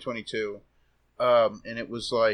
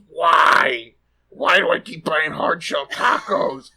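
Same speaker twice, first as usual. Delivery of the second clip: loud, higher, drawn-out grief-stricken cry; mock mournful wail; 4 semitones higher, 8 dB louder, much slower than usual. Marge mourning her exploded hard shell taco, mock mournful wail. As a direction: wail it slowly, imitating a bereaved Marge. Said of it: "like, mournful voice".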